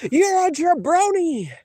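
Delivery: high, slow wrestling-promo yell; screamed insult. Retold or imitated. Streamer imagining screaming at his rival, high hammed-up yell. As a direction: bellow it like a heel wrestler. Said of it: "I'm gonna scream out".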